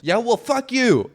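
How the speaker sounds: very high, loud, slow mock retort; mocking angry man. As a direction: shriek it like a petty scold. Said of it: "And then he says".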